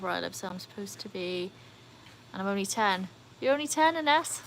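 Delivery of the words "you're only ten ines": higher-pitched surprised reaction; taken aback, pitch jumping up. Real, not acted. Streamer learns a young viewer is only ten, surprised.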